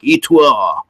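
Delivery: "Et toi" said the French way, like "ay twah"; echoing a French phrase. Retold or imitated. Ghost hunter repeats the spirit box's French words, lightly mimicked. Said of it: "It said something like".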